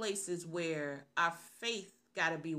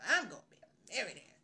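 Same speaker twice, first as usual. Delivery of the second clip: confident boast; 2 semitones higher, faster than usual. Retold or imitated. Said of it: "We say those things with confidence".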